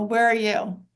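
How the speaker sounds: usual speaking voice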